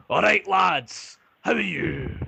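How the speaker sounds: big hearty dwarf greeting, high, loud and slow; burly dwarf barkeep. Acted. Dwarf barkeep greets the adventurers at the bar, high, loud and slow. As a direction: boom out a slow, jolly welcome behind the bar. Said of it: "and he goes".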